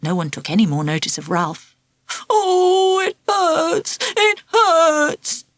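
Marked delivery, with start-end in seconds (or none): 2.3-5.5 high, whiny wail; whining in pain. Acted. Ralph to the class, whining over the bite. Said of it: "he kept on saying".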